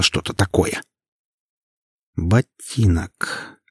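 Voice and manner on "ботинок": drawn out slowly. A narrator's reading. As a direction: let the word hang, very slow. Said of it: "медленно произнесла я".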